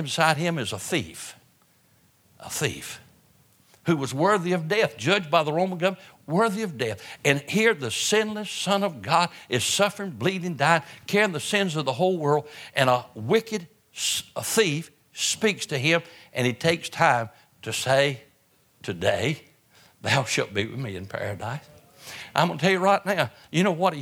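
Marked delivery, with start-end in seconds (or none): 2.4-3.0 hushed emphasis. Real, not acted. Emphatic repetition. 18.8-21.6 gentle promise. Acted. Quoting Jesus to thief.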